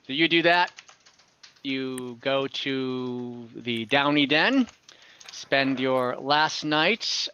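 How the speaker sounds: usual speaking voice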